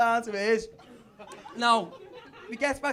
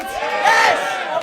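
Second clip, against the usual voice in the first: loud, higher warm-up; crowd hype shout; 6 semitones higher, 12 dB louder, slower than usual. Real, not acted. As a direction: get the room shouting back. Comic warming up crowd.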